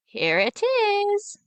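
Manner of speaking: high, very slow witch voice; creaky high old witch handing it over. Acted. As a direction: draw it out like a crooning hag. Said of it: "said the witch".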